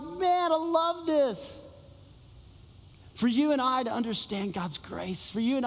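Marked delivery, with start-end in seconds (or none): 0.0-1.6 delighted. Retold or imitated. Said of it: "And I'm like".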